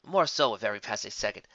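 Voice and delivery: usual speaking voice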